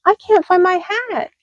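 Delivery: high dismayed wail; mock distress over hat; dismayed hedgehog. A narrator's reading. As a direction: hedgehog laments his hat. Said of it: "cried Little Hedgehog".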